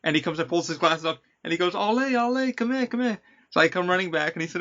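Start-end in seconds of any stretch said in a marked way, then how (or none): 1.7-3.2 high-pitched imitated Australian calling someone over; Australian accent. Excited Australian actor stops his coworker coming into work, imitated high-pitched Australian accent. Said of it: "his accent is pretty strong. It's Australian".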